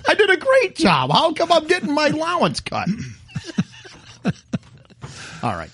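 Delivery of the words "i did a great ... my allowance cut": high, indignant wife voice. Pretend. Mocking friend's wife, high indignant. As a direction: protest the cut allowance.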